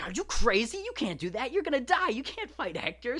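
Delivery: high, loud mock panic from everyone; alarmed crowd. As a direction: squeal it like the whole crowd can't believe him. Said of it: "And everyone's like".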